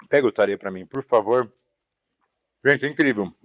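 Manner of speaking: talking through a mouthful; words round a bite of food; mouth full. Real, not acted. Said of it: "Sei que é difícil falar de boca cheia".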